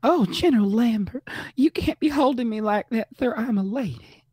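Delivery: high, loud Southern belle flirt. Pretend. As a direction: play a coy Southern lady, fluttering. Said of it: "Have you got injured".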